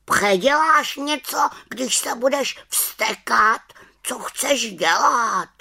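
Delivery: high, nagging raven voice; challenging raven. Acted. Raven challenging the angry witch, high scolding voice. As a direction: question her as a stern bird, pitched high.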